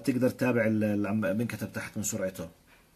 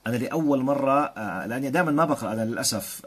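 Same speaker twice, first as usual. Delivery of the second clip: normal talking voice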